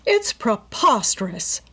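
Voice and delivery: slow, loud, outraged protest; indignant rich widow blowing up; storming. A narrator's reading. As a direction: spit each word out in disbelief. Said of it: "Missus Topham stormed".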